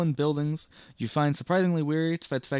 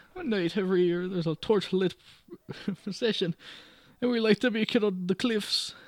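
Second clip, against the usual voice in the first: higher, lilting Irish telling; Irish landlady; 5 semitones higher, 3 dB louder than usual. Acted. May explaining the festival to the guest, Irish lilt. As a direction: chat in May's Irish lilt. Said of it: "let me go full Irish".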